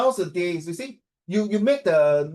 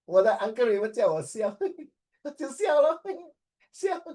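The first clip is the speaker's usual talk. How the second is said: high giggly laughs through the words; forced giggling; 9 semitones higher than usual. Retold or imitated. Student told to smile, giggling awkwardly. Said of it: "为什么你这样笑".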